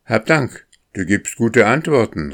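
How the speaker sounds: cheery storybook shout of thanks; calling out. A narrator's reading. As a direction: call your thanks down from above. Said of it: "rief der Junge".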